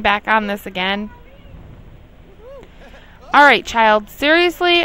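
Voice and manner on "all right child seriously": loud, fed-up scolding; getting angry. Real, not acted. Scolding the Sim child for wandering, loud angry call. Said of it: "I'm getting angry".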